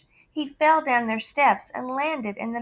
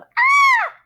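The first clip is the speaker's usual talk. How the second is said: frightened scream; 10 dB louder than usual. Acted. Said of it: "And the lady yelled".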